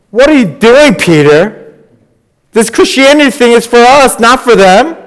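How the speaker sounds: high, loud mocking friends' voice; mocking friends. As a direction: throw the question up high and loud, scoffing. Friends questioning Peter, acted quote.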